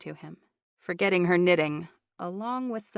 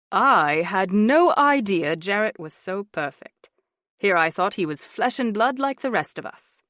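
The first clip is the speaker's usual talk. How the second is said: put-on English accent; 7 dB louder than usual. A narrator's reading. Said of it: "his English lilt more pronounced than usual".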